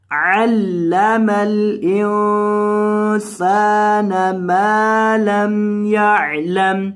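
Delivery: slow chanted tilawat; Quranic recitation. Shown as a demonstration. Teacher reciting for students, chanted. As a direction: draw out علم الانسان melodically.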